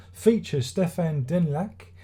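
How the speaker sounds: normal talking voice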